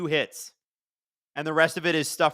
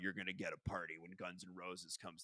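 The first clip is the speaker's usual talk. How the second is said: [name] voice; 7 semitones lower, 16 dB quieter than usual. Retold or imitated. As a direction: play the pompous rock star promising a party. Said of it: "we're gonna be [name] tonight".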